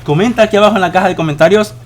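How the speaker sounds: usual speaking voice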